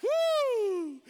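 high loud whoop; exultant shout; joyful whoop. Real, not acted. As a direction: let out a celebrating woo. Preacher whooping in worship.

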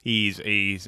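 ordinary delivery

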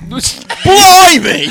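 loud, high put-on Aussie exclamation; laughing mock-Australian outburst; mock Australian accent. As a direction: blurt it in hammed-up Australian. Joins the mock Australian bit.

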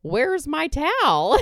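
high, slow put-on kid voice, laughing; kid's indignant question. Kid asking about his towel, high and laughing. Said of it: "And he's like".

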